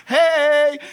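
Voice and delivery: high, very loud drawn-out hello; rowdy welcome. Retold or imitated. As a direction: holler a welcome to an old regular. Regulars greet Shelley.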